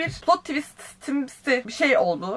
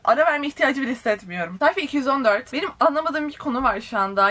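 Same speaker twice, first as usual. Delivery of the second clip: usual speaking voice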